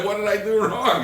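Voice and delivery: high, loud, baffled plea; hammed-up innocent protest amid laughter; mock bafflement. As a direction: wail it as a clueless husband.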